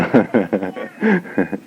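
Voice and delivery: real chuckle at own remark; amused laugh. Real, not acted. Teacher joking about the troublesome servant, amused laugh.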